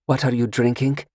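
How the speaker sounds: coy and sure of herself; seductive stranger. Acted. Seductive stranger asks Carter about his drink, coy. Female character voice.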